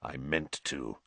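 low, soft growled threat. A narrator's reading. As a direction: growl it through bared teeth. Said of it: "he growled".

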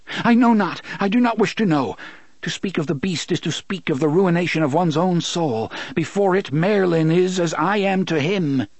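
high, beseeching, crumbling voice; pleading. A narrator's reading. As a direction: sternness breaking into begging. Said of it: "crumbled into beseechment".

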